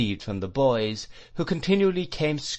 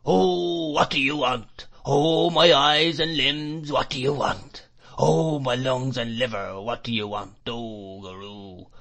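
put-on whining old man; 2 semitones higher than usual. A narrator's reading. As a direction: chant it, rising then falling.

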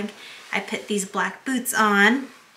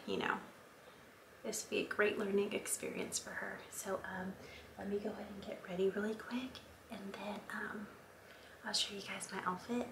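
whisper; 15 dB quieter than usual. Real, not acted. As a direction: keep it down to a breathy whisper. Whispering while baby naps.

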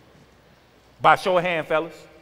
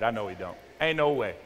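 usual speaking voice

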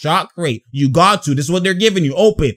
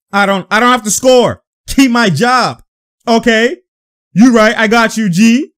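raised-voice trash talk, acted out; mock player backtalk; 4 semitones higher, 5 dB louder than usual. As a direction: voice a player answering back on court. Voicing players' heated exchange.

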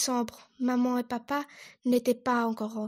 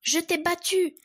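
higher, louder cry of victory; triumphant shout; 6 semitones higher, 4 dB louder than usual. Acted. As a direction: shout it from the top of the swing. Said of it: "ce fut de crier".

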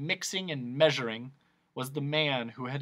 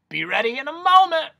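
high, loud, rising announcement; eccentric noise doctor; 13 semitones higher, 10 dB louder than usual. Acted. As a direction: call it out as the excitable doctor.

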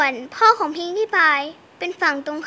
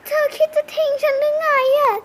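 hurt plea; 7 semitones higher than usual. Pretend. Abandoned pony pleads.

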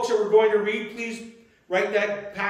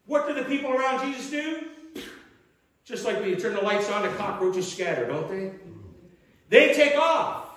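ordinary delivery

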